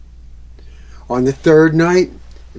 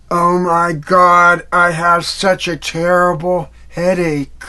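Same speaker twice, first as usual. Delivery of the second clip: groggy groan; 5 semitones higher, 4 dB louder than usual. Acted. Waking with headache.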